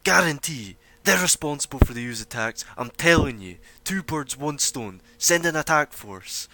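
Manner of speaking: the heckler shouting accusations; angry shout. Acted. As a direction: bark the call for an attack force. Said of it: "the same guy shouts".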